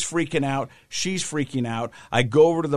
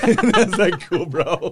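higher, louder words breaking into laughter; laughing; 6 semitones higher, 7 dB louder than usual. Real, not acted. Dad reacting to his son's honesty, laughing as he talks. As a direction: laugh through the line.

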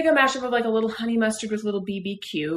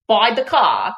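loud, theatrical character impression with an affected accent; Moira Rose; 7 dB louder, much slower than usual. Cohost quoting a TV episode to tease host, theatrical impression. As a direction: overdo each word like an affected TV diva. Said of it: "You sounded like Moira Rose".

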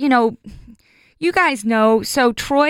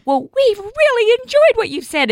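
much higher sweet praise; sweet friends; 12 semitones higher, 4 dB louder than usual. Retold or imitated. Class friends praising her, much higher and sweet. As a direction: gush kindly like a Sunday school friend. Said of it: "They were like".

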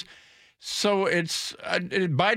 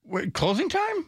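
surprised; 13 semitones higher than usual. Real, not acted. Host surprised song is that old.

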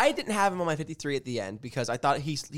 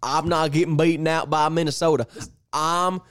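put-on Texas accent; 3 dB louder than usual. Cohost playing Jerry Jones crowing about the trade, in a Texas accent. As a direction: ham up a Texan owner boasting. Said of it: "That was a tough Texas accent you got there".

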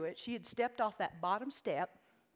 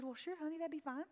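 higher, softer sweet mother; sweet mom voice; 6 semitones higher, 7 dB quieter than usual. Retold or imitated. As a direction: answer like an indulgent mom. Mother answering daughter sweetly.

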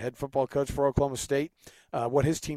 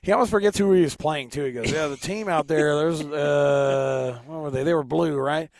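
Mike Gundy impression; 5 dB louder than usual. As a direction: play the folksy, distracted head coach. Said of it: "this raspy voice, though, really does let me get into my Mike Gundy".

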